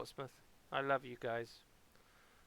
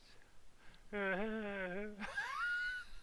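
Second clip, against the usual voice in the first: laugh shriek; 8 semitones higher than usual. Real, not acted. Burst after song.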